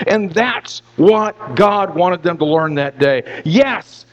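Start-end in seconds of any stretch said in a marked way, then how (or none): none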